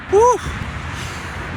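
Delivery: short happy woo; relieved cheer. Real, not acted. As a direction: whoop at the finish line. Finishing the run.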